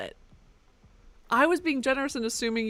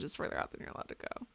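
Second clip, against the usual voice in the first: whispered; 12 dB quieter, much faster than usual. Real, not acted. Quiet aside while exploring.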